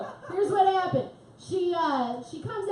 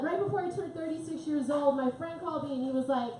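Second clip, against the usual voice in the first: normal talking voice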